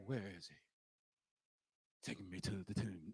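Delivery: very low, hushed, solemn parody; mock gentle Jesus. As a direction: send up a soft, pious saviour. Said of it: "he didn't say".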